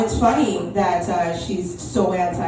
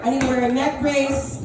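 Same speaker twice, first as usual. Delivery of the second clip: much higher, dramatic mock whine; hammed-up victim; 9 semitones higher than usual. Comic pretending to milk the car crash, hammed-up wail. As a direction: overplay the injured victim. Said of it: "I would have been like".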